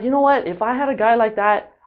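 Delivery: girl at the bar ranting angrily, imitated; angry rant. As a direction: imitate her irritated outburst. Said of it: "like, really angry".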